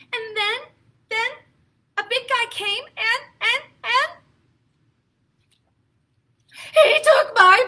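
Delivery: high, tearful little pig, stammering; upset Piggy. Acted. Piggy telling Gerald about the big guy, high and tearful. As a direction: falter on "and, and" then wail "he took my ball". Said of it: "He's very upset".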